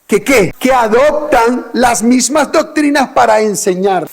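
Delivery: high, loud fiery preaching with a slow punch on key words; preaching at full force. Acted. Preacher drilling the congregation on Babylon, fiery and loud. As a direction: hammer each answer at the congregation.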